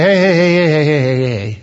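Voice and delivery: rapid string of heys, fading away; mock soothing interruption. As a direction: break in to hush mourners, then taper off. Imagined Jesus breaking in to hush mourners.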